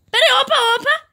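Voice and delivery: high, loud startled gasp. Real, not acted. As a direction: jump at a sudden sight. Spots something on camera.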